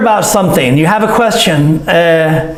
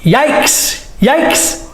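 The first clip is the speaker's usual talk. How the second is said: mild yikes; 6 semitones higher than usual. Shown as a demonstration. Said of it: "For fear of concern".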